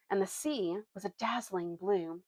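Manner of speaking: ordinary delivery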